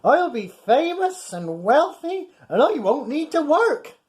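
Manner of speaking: high, gloating skipper voice; smug skipper. Acted. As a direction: gloat over the catch. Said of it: "he thought with a smirk".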